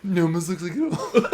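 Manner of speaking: giggling through the words; laughing. Real, not acted. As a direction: laugh while saying it. Joking about the almond line.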